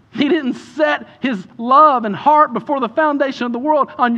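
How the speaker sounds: high, loud building sermon shout; preaching at full force. Real, not acted. Pastor shouting to the congregation at full force. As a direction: preach it out at the top of the voice.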